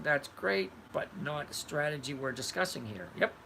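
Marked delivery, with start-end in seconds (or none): none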